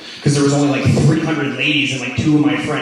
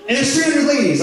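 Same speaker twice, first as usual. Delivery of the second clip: mock organizer; 11 semitones higher than usual. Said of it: "I was like".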